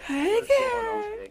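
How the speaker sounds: breathy flirty high voice; sultry come-on voice; sexy voice. Acted. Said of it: "she got her little sexy voice on".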